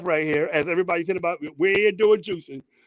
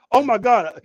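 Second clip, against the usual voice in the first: normal talking voice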